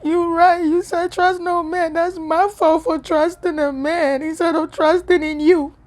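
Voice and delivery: crying out her admission to God; bawling. Retold or imitated. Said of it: "And now I'm bawling".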